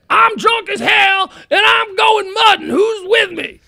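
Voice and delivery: put-on drunken rallying cry. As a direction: bellow it like a drunk rounding up friends. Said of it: "His last words".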